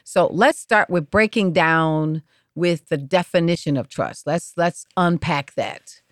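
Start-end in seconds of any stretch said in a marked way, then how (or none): none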